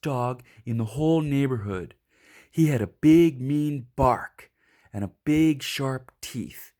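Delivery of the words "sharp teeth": ordinary delivery, nothing marked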